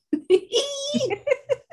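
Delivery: squealing laugh, high and bright. Real, not acted. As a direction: let out a high joyful squeal. Excited laughing cheer.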